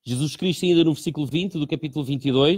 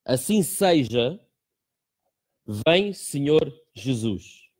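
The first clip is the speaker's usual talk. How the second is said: slow, drawn-out prayerful reading; much slower than usual. Shown as a demonstration. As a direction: pray each word slowly and solemnly. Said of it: "tem essa pequeníssima oração que diz".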